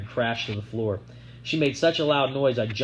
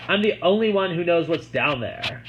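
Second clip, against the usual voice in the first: boastful taunt; 6 semitones higher, 3 dB louder than usual. A narrator's reading. Sissy boasting.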